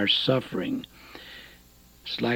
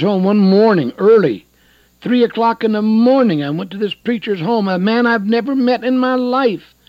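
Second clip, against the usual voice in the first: loud, high preacher's testimony; preaching testimony; 6 semitones higher, 6 dB louder than usual. Real, not acted. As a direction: raise the voice and testify. Testifies at raised pitch.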